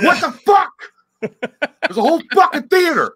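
loud, high exclamation of disbelief; outraged yell. Retold or imitated. Retold outrage at full heads.

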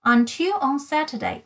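ordinary delivery, nothing marked

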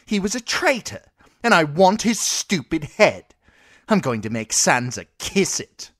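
put-on a furious spoilt child-king throwing his weight; spoilt boy king.

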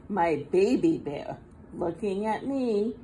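usual speaking voice

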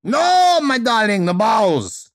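loud, very high Russian wail, falling on "bowels"; Madame Fedra, insistent Russian accent. Acted. Exasperated teacher correcting her student, high insistent Russian accent. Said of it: "she repeated".

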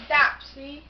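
ordinary talk, nothing marked

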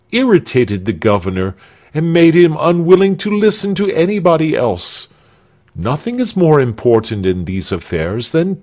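lightly voiced smug townsman lecturing the others; lecturing know-it-all. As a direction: lecture them on tact, then sulk. Arnold of Sewa lecturing on tact.

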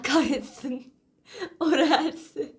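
laughing. Real, not acted. Laughing about her viral reel.

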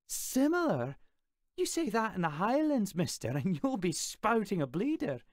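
put-on high, loud, furious Scottish girl; fierce Scottish threat. A narrator's reading. Claire threatens the boy over calling accents similar, angry Scottish voice. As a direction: warn him off like a Highland brawler.

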